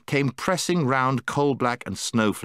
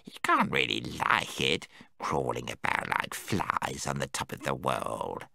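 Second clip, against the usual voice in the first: much lower, softer gnome disdain; 10 semitones lower, 7 dB quieter than usual. Acted. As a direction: gnome pitying surface dwellers. Golg scorns surface life.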